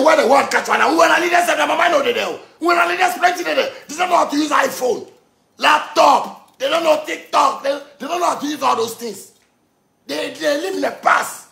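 high-pitched, heated complaint; exasperated rant. Real, not acted. Ranting at leaders who don't know technology, exasperated. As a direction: rant about clueless leaders, voice pitched right up.

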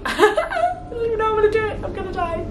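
high, laughing and crying at once; laughing through tears. Real, not acted. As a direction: let the laugh crack into tears. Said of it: "sappy and emotional".

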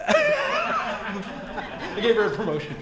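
amused, words breaking out of a laugh. Real, not acted. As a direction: laugh off your own slip. Laughs at own title slip.

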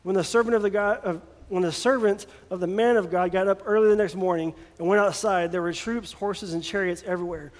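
usual speaking voice

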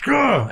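high-pitched mock groan of defeat; exasperated groan. Dad gives in to hungry kids, exasperated groan.

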